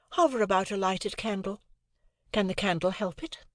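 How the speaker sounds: a hint of a haughty young lady; Estella. Estella voiced, higher.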